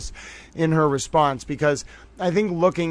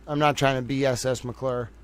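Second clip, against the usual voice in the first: ordinary talk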